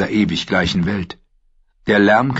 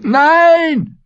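very high, loud desperate scream; 7 dB louder than usual. A narrator's reading. Prisoner screaming as the cell door slams on him. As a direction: scream it in panic. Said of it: "schrie er".

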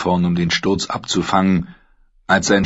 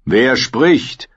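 demanding knight; 6 semitones higher, 3 dB louder than usual. A narrator's reading. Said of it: "wollte Sir Aenys Fry wissen".

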